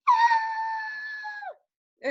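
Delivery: very high startled shriek; wife shrieking as a snake bites her husband; retold scream. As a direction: shriek in shock at the snake bite. Wife sees snake bite husband.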